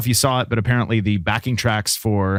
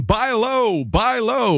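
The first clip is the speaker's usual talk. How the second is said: trader shouting; 10 semitones higher, much slower than usual. Acted. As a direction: call it out like a floor trader. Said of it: "when everyone was shouting".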